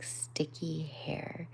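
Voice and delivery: ordinary delivery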